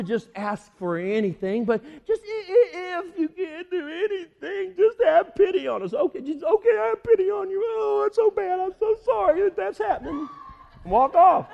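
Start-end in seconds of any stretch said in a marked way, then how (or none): 2.1-5.9 high, whimpering pious beggar; hammed-up tearful contrite plea; put-on pitiful whine; falsely humble pleading. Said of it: "it seems so humble and contrite and so pious". 5.9-10.3 high, gushing fake sympathy; hammed-up commiseration; mock pity. Mocks Jesus giving mere pity.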